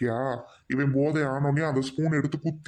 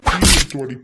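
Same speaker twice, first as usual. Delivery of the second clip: loud bellowed order; sudden angry yell; angry shout; 10 dB louder than usual. Acted. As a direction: bark the order at full volume. Drunk husband orders wife about.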